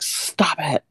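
high-pitched mock yell, held back in volume; strained imitation of a desperate yell; Tom Atkins. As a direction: put on a half-hearted, high yell. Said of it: "impression of Tom Atkins yelling".

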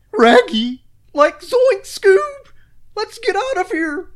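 high, slow cracking Shaggy voice. Acted. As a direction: panicky cartoon stoner, dragged out. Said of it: "Gable's got a better Shaggy".

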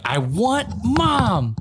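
put-on high, very slow, drawn-out child plea; whiny little girl. Dad imitating his daughter calling for mom, high and slow. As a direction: stretch it out like a sleepy kid. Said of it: "So she's like".

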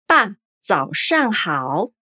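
爸，早上好 said slowly with clear tones; slow Mandarin. Shown as a demonstration. Teacher models dad, good morning slowly for repetition. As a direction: give 爸，早上好 word by word. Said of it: "como seria?".

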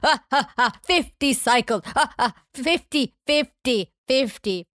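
villain line repeating like an echo; mock evil announcement with echoing laughs; imitated slow-motion echo. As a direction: repeat and fade like a film echo. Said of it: "having this echo on top of it".

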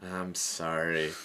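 soft, slow sheepish apology; mock sheepish apology; sheepish sorry. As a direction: caught red-handed, meek. Caught stealing, apologises.

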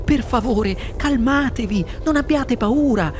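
put-on calm the old folks; boy's voice. Charlie soothing. (ragazzo che rassicura)

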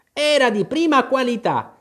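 high-pitched apologetic defence. A narrator's reading. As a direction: excuse yourself in a high voice. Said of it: "rispose come scusandosi". (tono di scusa, acuto)

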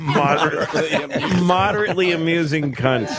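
repeating it through laughter; chuckling while quoting; laughing. Real, not acted. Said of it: "some comedian should have that".

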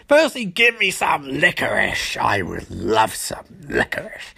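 hammy villain, sinking low at the end; Trickster. Retold or imitated. Villain demanding licorice, theatrically menacing. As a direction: savour the demand, dropping to a low rumble. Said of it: "Atritsu gets furious".

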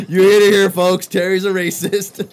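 very loud, high proclamation; mock public announcement. Game master teasing a player before the folks, mock announcement. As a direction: announce it to the crowd in playful outrage, slowing at the end.

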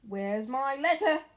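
loud slow demand. A narrator's reading. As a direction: demand it angrily. Harry demanding.

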